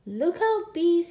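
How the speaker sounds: high, loud warning shout. A narrator's reading. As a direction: yell it out to frighten the bees. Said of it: "he shouted".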